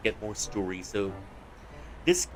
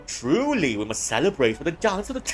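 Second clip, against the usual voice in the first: overacted posh; 4 semitones higher, 5 dB louder than usual. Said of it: "overacting this on purpose".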